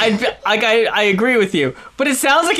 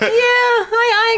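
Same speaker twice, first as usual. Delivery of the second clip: high, drawn-out hesitant squeak; retold reluctant agreement; 14 semitones higher than usual. Recalling being unsurprised by the news, high hesitant agreement. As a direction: concede in a high, mock-reluctant voice. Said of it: "I was like".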